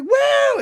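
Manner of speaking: very high, loud imitated kids' whoop; excited whoop. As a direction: let out a crowd-of-kids woo.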